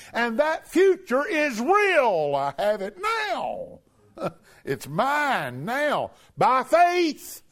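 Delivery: high, loud, slow and exultant; preaching shout. Real, not acted. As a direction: build it up to a triumphant cry. Preacher builds to climax.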